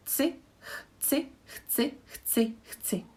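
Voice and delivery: chci repeated, ch and c kept apart; chci drilled. Shown as a demonstration. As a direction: drill chci over and over, separating ch and c. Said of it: "try to separate the ch and c".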